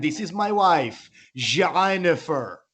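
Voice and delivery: slow, stretched put-on Iranian accent; mock Iranian accent. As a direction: show off a fake Iranian accent, dragging the name out. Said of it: "got back three percent Iranian".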